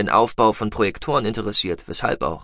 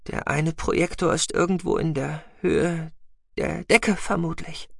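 breathed; 7 semitones higher than usual. A narrator's reading. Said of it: "hauchte Zahira".

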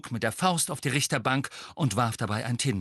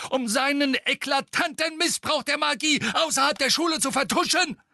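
pompous mayor; 4 dB louder than usual. A narrator's reading. Fudge ranting.

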